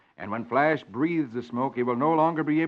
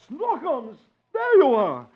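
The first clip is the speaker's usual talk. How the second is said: much higher, loud joyful relief; relieved exclaim; 12 semitones higher, 6 dB louder, slower than usual. Acted. Archie finds his baby, high and relieved. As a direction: cry out finding the baby at last.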